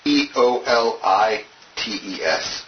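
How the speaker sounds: slow, letter by letter; spelling it out. Shown as a demonstration. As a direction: spell out each letter slowly for note-takers. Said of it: "How do you actually spell it?".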